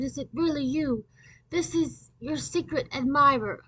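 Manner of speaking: high, swooning fake admirer; disguised voice. Debbie on tape, confessing love to Howard, disguised. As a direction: gush it like a lovesick secret admirer. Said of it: "I disguised my voice".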